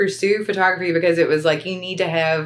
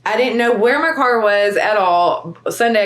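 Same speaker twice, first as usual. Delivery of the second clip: ordinary talk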